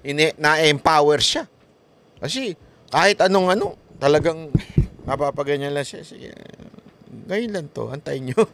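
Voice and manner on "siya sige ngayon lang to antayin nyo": muttered threat. Retold or imitated. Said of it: "ganun ang nasa isip niya".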